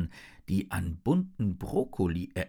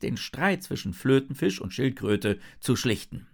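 usual speaking voice

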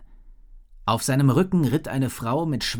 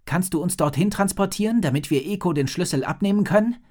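put-on eager boy; 7 semitones higher, 3 dB louder, faster than usual. A narrator's reading. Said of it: "fragte er".

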